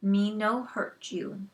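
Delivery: slow, simple pidgin words; mildly slowed pidgin; broken English. Acted. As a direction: talk slowly and simply to be understood. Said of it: "Indian English".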